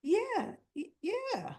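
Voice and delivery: high, stammering yeah, caught out; cornered girl stumbling over a yes; nervous stammer. Retold or imitated. Daughter caught lying to Jason, nervous high stammer. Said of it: "And Jocelyn's like".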